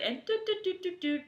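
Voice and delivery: high-pitched "doot doot" key taps; imitated texting noise. Co-host showing a date texting during her story, high doots. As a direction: mimic busy texting in quick high doots.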